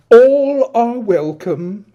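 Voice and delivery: grand welcome. Acted. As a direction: announce it like a host. Adrian grandly invites.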